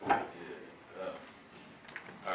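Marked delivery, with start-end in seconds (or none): none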